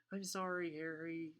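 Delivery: high, plaintive apology; wailing. A narrator's reading. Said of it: "she wailed".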